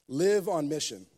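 ordinary talk

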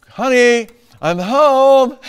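loud, high holler through the house; husband hamming up his call to his wife; exaggerated homecoming call. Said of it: "I may not say it exactly that way".

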